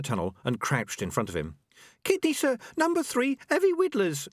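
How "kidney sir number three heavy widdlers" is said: very high, urgent squeaky rat voice; high-pitched rodent blurting a report; breathless small rat. A narrator's reading. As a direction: burst it out like a frantic little rat. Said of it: "out of breath ... it burst out".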